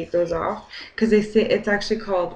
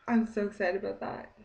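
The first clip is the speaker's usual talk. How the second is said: usual speaking voice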